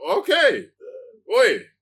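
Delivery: high, loud impressed reaction; acting out an impressed fan egging someone on; hyped approval. Fan reacting to Gunn doing the film, loudly impressed. Fan cheering on Gunn's project.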